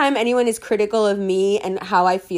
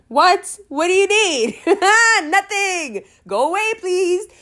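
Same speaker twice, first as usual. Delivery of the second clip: high, startled what and shooing away; startled and shooing someone off; mock startled; 10 semitones higher than usual. Startled at being interrupted, high and shooing.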